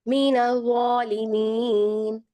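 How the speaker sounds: slow, drawn-out tajweed recitation; Quran recitation. Acted. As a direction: recite the verse slowly and melodically.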